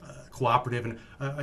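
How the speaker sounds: ordinary delivery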